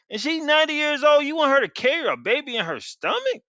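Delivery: high, disbelieving question; incredulous. Acted. Abraham questioning God about Sarah, high disbelief. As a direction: sound amazed anyone would ask this.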